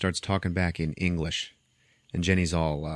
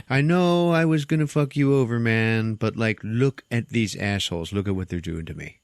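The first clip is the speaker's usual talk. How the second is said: innocent pleading; 3 semitones higher than usual. Retold or imitated. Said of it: "very innocent, pleading eyes".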